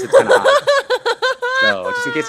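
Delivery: squeaky high laughing voice; high falsetto. Pretend. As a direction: jump way up into a giggly falsetto. High laughing falsetto after Elmo talk.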